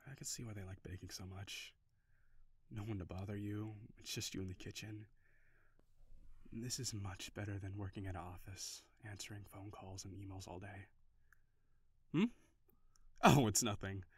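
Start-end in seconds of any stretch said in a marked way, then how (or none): 0.1-10.9 much softer, relaxed, low-key; thinking aloud peacefully in the kitchen; soft contented musing. Acted. Content calm musing while baking.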